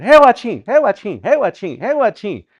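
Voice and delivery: high, repeated singsong begging; pestering kids. Acted. Kids begging all through Walmart, insistent high singsong. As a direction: loop it like a child nagging in a store. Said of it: "if you teach your kids this".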